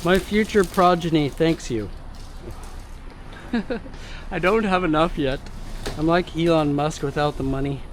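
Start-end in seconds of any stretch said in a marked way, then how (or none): 2.0-4.2 breathy laugh. Real, not acted. Laughs at own joke.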